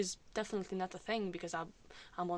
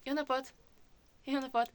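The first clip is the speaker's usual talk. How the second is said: higher-pitched feminine greeting voice; old upper voice; 6 semitones higher, 4 dB louder, faster than usual. Shown as a demonstration. Anxious vlogger greeting someone threatening, in the old higher voice. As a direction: jump up into the anxious high register. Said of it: "so I could still go".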